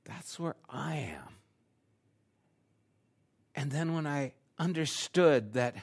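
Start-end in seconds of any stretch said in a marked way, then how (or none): 0.0-1.4 quiet realization. Retold or imitated. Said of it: "I was like".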